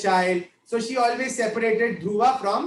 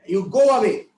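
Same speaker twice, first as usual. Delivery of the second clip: put-on a loud, sharp "go away" at the boy; mock-stern stepmother ordering him off; scolding; 5 dB louder than usual. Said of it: "she scolds this little boy".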